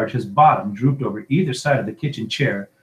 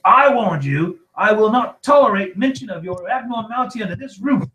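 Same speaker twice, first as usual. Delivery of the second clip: high, loud, outraged voice; raised-voice tirade; furious scolding; 11 semitones higher, 5 dB louder than usual. A narrator's reading. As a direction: lay down the law in a rage. Uncle's furious tirade at his nephew.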